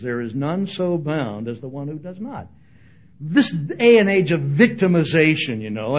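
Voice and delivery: ordinary talk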